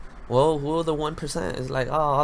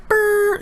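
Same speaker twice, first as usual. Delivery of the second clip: high buzzing brr like an alarm; alarm imitation; 7 dB louder than usual. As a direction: buzz it like a tiny alarm, high. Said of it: "a little alarm".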